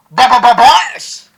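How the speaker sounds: booming, drawn-out stuttered yell; stuttered hype shout. Real, not acted. Hype shout after rap punchline. (pautal-utal na malakas na sigaw)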